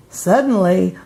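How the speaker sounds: usual speaking voice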